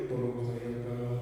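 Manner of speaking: soft, low inward plea; low, soft whispered-in-the-heart plea; silent prayer. Shown as a demonstration. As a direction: pray it quietly inside, unheard. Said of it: "dia akan bilang ini".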